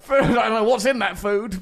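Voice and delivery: laughing while talking; words breaking into laughter. Real, not acted. Laughing through line.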